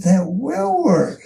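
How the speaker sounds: retold delight, high and drawn-out WILL; enthused. Doubting engineer comes round to the modified idea, enthused. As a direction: stretch WILL high in dawning conviction. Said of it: "he got so enthused about it".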